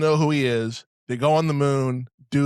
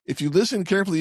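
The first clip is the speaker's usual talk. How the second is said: ordinary talk, nothing marked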